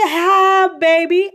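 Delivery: grandma picking up the phone; sweet grandma. Retold or imitated. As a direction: coo it like a doting granny.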